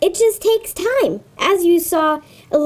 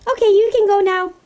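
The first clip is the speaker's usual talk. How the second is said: ordinary delivery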